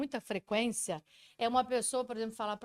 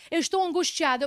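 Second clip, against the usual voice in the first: quoting a client; 5 semitones higher, 9 dB louder than usual. Retold or imitated. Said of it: "eu ouço assim no consultório".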